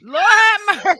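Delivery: loud, high cry while laughing; laughing outcry. Real, not acted. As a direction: cry it out, cracking up. Overcome by laughter.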